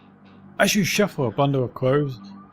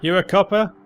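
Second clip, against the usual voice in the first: put-on higher, louder wary old man; suspicious old man; 6 semitones higher, 4 dB louder than usual. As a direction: squint and suspect them. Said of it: "squints at you, suspicious".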